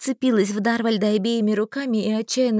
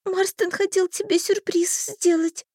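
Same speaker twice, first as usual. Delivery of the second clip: put-on sobbing girl; 11 semitones higher than usual. A narrator's reading. Girl answers Darvald, high and sobbing. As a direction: sob it out high.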